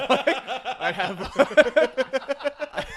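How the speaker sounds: breaking into high laughter; laughing too hard to finish. Real, not acted. Laughing while telling friends the story.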